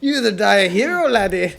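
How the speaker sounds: playful mock-Scottish laddie voice; quick, hammed-up Scottish burr; Gerard Butler. Friend teasing back with a mock-Scottish hero line. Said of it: "you sound like Gerard Butler".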